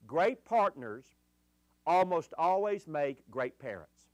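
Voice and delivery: "Great partners almost always make great parents" spelled out slowly; slow dictation. Shown as a demonstration. A pastor dictating "Great partners almost always make great parents" to note-takers, slowly. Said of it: "just write this little phrase".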